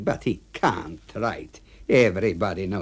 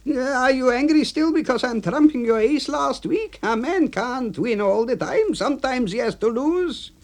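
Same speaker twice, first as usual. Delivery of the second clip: high voice for A B. Acted. AB voiced in story.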